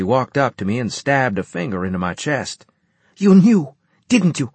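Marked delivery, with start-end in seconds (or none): 3.2-4.5 high, loud furious accusation; accusing shout. A narrator's reading. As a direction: jab it at him, shouting. Accusing, finger in chest.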